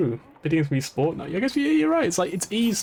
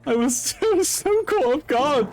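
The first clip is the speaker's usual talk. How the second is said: amazed; 11 semitones higher than usual. Real, not acted. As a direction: keep squealing in lingering disbelief. Still squealing over retold shock.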